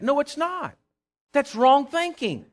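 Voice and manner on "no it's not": ordinary delivery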